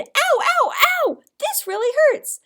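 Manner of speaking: high, loud pained shout. Shown as a demonstration. Teacher repeating the shouted ow for the class. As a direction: cry out in pain. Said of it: "I shouted".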